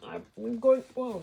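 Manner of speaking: straining under a heavy lift; grunting while lifting; effort grunt. Real, not acted. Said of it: "Sorry for the grunting".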